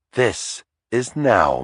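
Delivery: slow, tender, close; intimate. A narrator's reading. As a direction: draw him in, slowly. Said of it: "pulling me closer".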